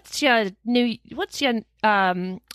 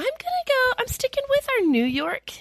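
pitched way up, teasing; high playful voice. Real, not acted. Playful lead-in to her story.

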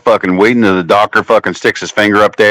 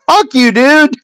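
dog's voice; 13 semitones higher than usual. Retold or imitated. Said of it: "He's like".

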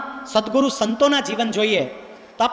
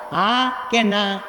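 drawn-out question; 2 semitones lower, much slower than usual. Acted. Asks the parents to answer.

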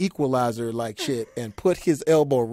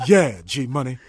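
laughing impression; 5 dB louder than usual. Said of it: "Wesley always laugh before he say something".

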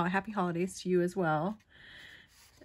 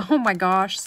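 excited; 11 dB louder than usual. Real, not acted. Delighted exclamation.

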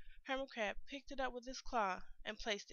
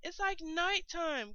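higher, louder, slow cry; cried out; 7 semitones higher, 5 dB louder, slower than usual. A narrator's reading. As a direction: wail it out into the dark. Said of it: "cried the sea urchin".

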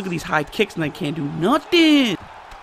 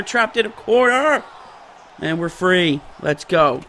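usual speaking voice

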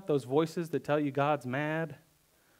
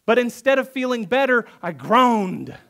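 higher and louder, exasperated; frustrated complaint; 7 semitones higher, 6 dB louder than usual. Retold or imitated. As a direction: complain it's not working. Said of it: "He says".

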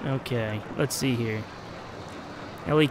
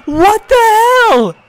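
loud, much higher, drawn-out disbelieving cry; real shock, stretched high and loud; shocked outburst; 11 dB louder, much slower than usual. Real, not acted. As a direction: blurt it out, stunned, as the ride fails. Coaster crash shocks the player.

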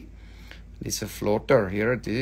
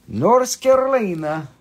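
higher, drawn-up "North Carolina"; exaggerated place name; 5 semitones higher, 4 dB louder than usual. Seller showing off a crystal's origin, exaggerated. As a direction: punch up "North Carolina" high.

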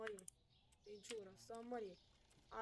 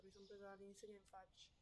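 ordinary delivery

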